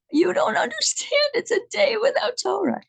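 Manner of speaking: high, urgent mother's voice; upset mother. Retold or imitated. Mother tells her boy a lost day matters, high and urgent.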